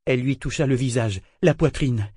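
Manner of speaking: ordinary delivery, nothing marked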